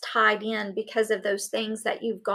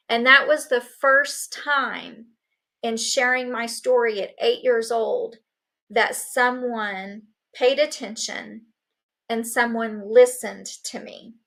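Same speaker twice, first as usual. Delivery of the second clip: ordinary delivery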